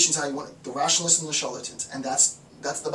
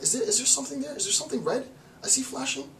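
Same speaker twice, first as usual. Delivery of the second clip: curious peering; 9 semitones higher than usual. Retold or imitated. Institution spots a flashing dot.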